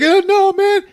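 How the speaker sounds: high, loud pleading whimper; high whine of dread. Retold or imitated. Skip dreading the semen sample, pleading high. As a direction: beg them not to. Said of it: "And he's like".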